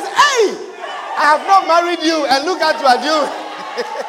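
high, loud "Hey" bursting out, then a scolding; indignant fiance's loud rebuke; angry outburst. Acted. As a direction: scold like a jilted fiance calling it off. Fiance cancels wedding angrily.

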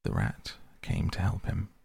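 ordinary delivery, nothing marked

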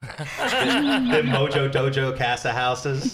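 loud, slow, drawn-out laughing quote; laughing mock quote. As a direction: stretch each word out while cracking up. Quoting Barbie film line laughing.

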